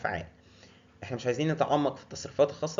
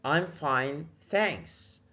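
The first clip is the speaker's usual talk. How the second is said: normal talking voice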